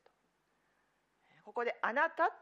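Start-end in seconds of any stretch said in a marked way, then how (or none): 1.8-2.3 あなた lifted high and loud, set apart; stress on あなた. Shown as a demonstration. Preacher pointing out the switch to あなた, stressing it high and loud. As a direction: hit the word あなた hard to mark the change. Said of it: "と言い換えてるんですね".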